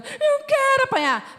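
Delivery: very high, slow squeaky "I want it"; squeaky mock-childish volunteer; mock eager child. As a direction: play the little kid, hammed up. Son mocking willing to be spanked.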